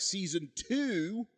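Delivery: dramatic slow reveal. Acted.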